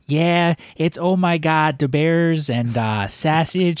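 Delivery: slow, stretched Chicago vowels; Midwest Chicago accent. Retold or imitated. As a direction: mimic a coworker's thick Chicago accent. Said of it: "that Midwest Chicago accent".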